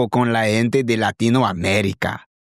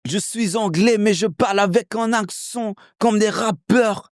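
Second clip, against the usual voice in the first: high-pitched French street rap accent; French rapper accent; 11 semitones higher than usual. Shown as a demonstration. Showing how French hip hop changed his accent, rapper-style. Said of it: "listening to a lot of French hip hop".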